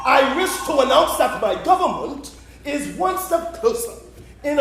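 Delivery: orate grandly to the house; pompous parliamentary speech. Acted. Prime minister rehearsing his address to the Speaker, pompous and emphatic.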